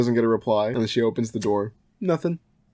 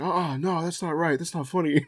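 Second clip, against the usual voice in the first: quoting dad; 7 semitones higher, 3 dB quieter than usual. Retold or imitated. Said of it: "He's like".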